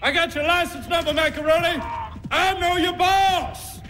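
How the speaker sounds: yelled from a departing car, high and distant; shouted from afar. Acted. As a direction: call it back while driving away. Salesman shouts while driving off.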